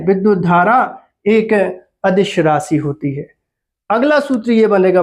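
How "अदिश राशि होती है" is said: ordinary delivery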